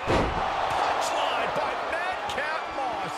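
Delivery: slow, drawn-out shouted move call; wrestling commentator. Acted. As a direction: belt out each move name. Play-by-play calling big moves.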